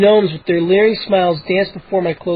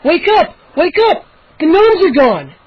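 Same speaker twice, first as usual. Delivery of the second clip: much higher, loud urgent cry; frantic wake-up; 11 semitones higher, 5 dB louder than usual. A narrator's reading. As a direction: shake them awake in panic. Boy wakes parents urgently.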